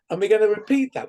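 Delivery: ordinary delivery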